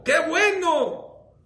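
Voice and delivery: "bueno" stretched long, high and loud; preacher's slow, high, loud "¡Qué bueno!"; drawn-out exclamation. Shown as a demonstration. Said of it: "¡Qué bueno que no tienes pedido".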